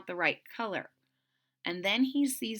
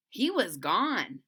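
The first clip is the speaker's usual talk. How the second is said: ordinary delivery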